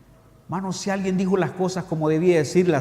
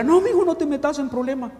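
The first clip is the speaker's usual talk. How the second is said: higher, pleading mother's voice; a hint of a worried mother begging; 8 semitones higher than usual. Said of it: "Y la señora". (madre suplicante, voz más aguda)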